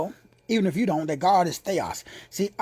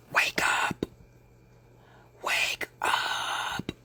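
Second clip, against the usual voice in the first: hushed repeated call; whispered wake up; 7 dB quieter, much slower than usual. Real, not acted. Preacher whispering to rouse the listener. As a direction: breathe it out as a soft warning.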